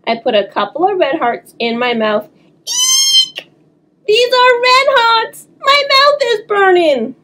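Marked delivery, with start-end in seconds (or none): 2.6-7.1 very high shriek of disgust; squealing yell. Acted. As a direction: shriek at the burning taste. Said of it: "I yelled".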